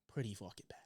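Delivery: hushed, dismissive repeat; whispered aside. Real, not acted. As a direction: whisper it under your breath.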